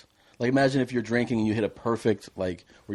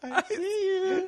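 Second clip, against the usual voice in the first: laughing mock doctor; much slower than usual. Laughing at the ICU bit, mock doctor saying I see you. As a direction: choke out a high, slow I see you through laughter.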